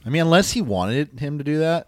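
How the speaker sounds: usual speaking voice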